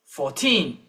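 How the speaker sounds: put-on fourteen with the weight on teen; stress on teen. Shown as a demonstration. Teacher modelling fourteen for the class, stress on teen. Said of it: "the stress is on teen".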